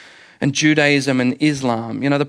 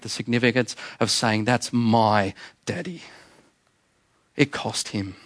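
tender hush; 4 semitones lower, 4 dB quieter than usual. Real, not acted. Tender hushed close.

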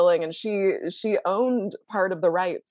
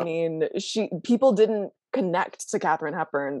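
ordinary talk

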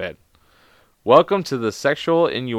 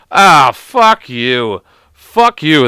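loud, indignant swearing; exasperated outburst; 3 semitones higher, 7 dB louder, much slower than usual. Real, not acted. Disgusted by the can't opener joke, exasperated outburst. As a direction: curse the joke out loudly.

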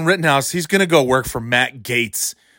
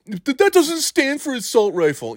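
much higher mock-nitpicker voice; mocking idiot pedant; 10 semitones higher than usual. Host imitating idiots who argue over rifle names, mocking. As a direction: mimic a silly, sputtering objection.

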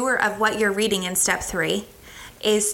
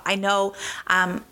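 normal talking voice

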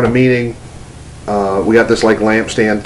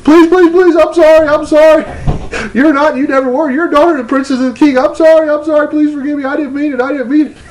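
very high, squealed mock pleading; frantic begging; faster than usual. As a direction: beg forgiveness in a rushed, piercing wail. Frantic apology acted on knees.

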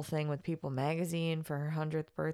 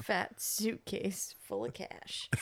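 slow amused echo; 3 semitones higher, slower than usual. Real, not acted. Echoing slowly, amused.

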